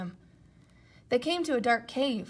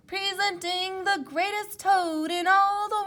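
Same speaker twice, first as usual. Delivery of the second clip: announcer voice; 10 semitones higher, 6 dB louder than usual. Acted. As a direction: present Toad like a circus ringmaster. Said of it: "A strange voice from far away said".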